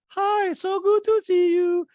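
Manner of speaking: loud, drawn-out gushing squeal; hammed-up falsetto greeting; very high falsetto. Host greets Kathy, in loud slow mock falsetto. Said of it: "And Daddy goes".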